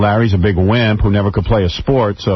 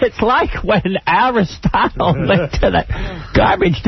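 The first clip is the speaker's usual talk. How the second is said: high, slow, cracking himself up; giggling through his own joke; laughing at own joke; 8 semitones higher, slower than usual. Retold or imitated. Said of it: "He's breaking himself up".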